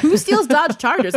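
can't believe anyone would steal that; shocked. Retold or imitated. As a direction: clerk laughs in shock at the stolen car. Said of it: "she was so shocked".

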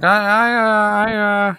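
Michael Moriarty. Retold or imitated. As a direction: imitate him with a high, drawn-out stammer. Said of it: "He's like".

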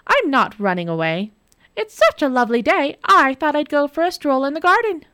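high, bright, falsely cheerful; sweetly innocent. Acted. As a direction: play innocent with a smile. Nora feigning cheer.

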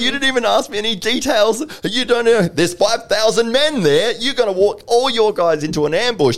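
very high, loud furious yell; accent dropped, outraged. Acted. Instructor tearing into a recruit's plan, outraged yell. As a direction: explode at the recruit, acting it out in full. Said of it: "the accent just disappears".

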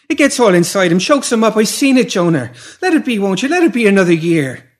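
very high, loud desperate begging; high, urgent, insistent plea; pleading mother. Acted. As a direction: beg frantically for the child, voice right up.

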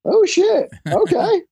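real shock, voice shooting up high; high-pitched startled curse; shocked oh shit. Real, not acted. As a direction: exclaim in sudden alarm. Reacting to opponent's page.

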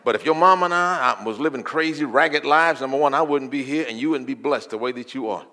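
loud, high, driving sermon delivery; preach. Real, not acted. Preacher testifies to the church about family blessing, full-force preaching.

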